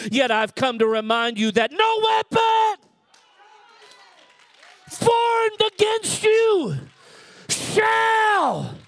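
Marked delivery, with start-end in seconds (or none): 1.7-8.7 shouted, much higher, stretched slow; preaching climax. Acted. Pastor promising the church no weapon prospers, ringing shout. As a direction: lift it to a ringing shouted peak.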